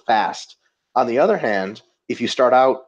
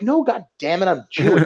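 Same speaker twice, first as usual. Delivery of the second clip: indignant Ito; 7 semitones higher than usual. Retold or imitated. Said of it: "He's like".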